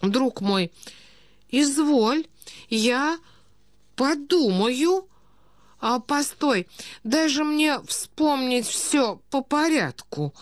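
put-on high, halting old prince; befuddled old prince. A narrator's reading. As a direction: recall slowly, piece by piece, as a doddering aristocrat. (старый князь, медленно припоминает, с паузами)

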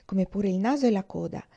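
ordinary delivery

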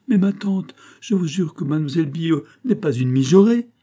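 acted indignant defence; protesting. A narrator's reading. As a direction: plead her innocence insistently. Nephew protests to angry aunt.

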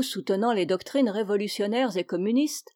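ordinary delivery, nothing marked

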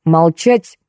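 ordinary delivery, nothing marked

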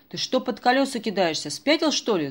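high, loud furious shout; angry yell. A narrator's reading. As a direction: roar at the man in the road. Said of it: "заорал шофёр".

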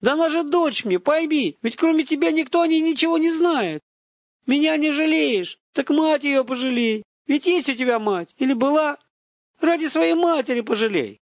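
very high, impassioned pleading; distraught high-pitched entreaty; desperate begging. A narrator's reading. Father begs for his daughter.

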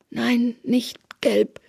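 high, slow, drawn-out plea; pained denial. A narrator's reading. Beaten informer answering Therese, pleading denial. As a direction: drag out each word as a weak protest.

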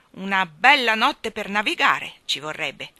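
put-on loud grumbling old admiral; lightly voiced gruff admiral; hoarse grumble. A narrator's reading. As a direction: grumble it like a crusty sea dog. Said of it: "brontolava l'ammiraglio Boom con la sua voce roca".

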